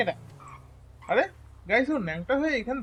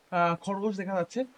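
ordinary talk

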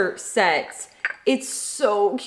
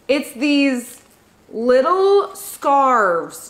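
usual speaking voice

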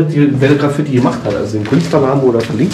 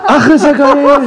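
excited; 13 semitones higher, 4 dB louder, slower than usual. Real, not acted. Delighted exclamation while laughing.

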